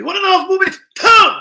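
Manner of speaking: high, shouted chef command; mock chef. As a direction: yell the turn like a kitchen boss. Mock chef calling the turn.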